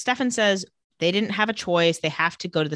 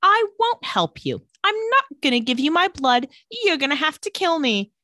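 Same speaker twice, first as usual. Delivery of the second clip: high, loud defiant refusal; a young woman standing her ground, high and loud; defiant Elena; 10 semitones higher, 4 dB louder than usual. Retold or imitated. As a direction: refuse her flatly and loudly. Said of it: "So she says".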